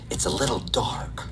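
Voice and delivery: hushed secretive whisper; whispered aside. Retold or imitated. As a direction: lean in and whisper it like a dirty secret. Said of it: "She goes".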